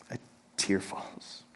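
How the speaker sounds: breathy hushed whisper, slow; a soft slow whisper for a falling tear. Acted. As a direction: let it fall out as a bare whisper. Describing a tear falling at death.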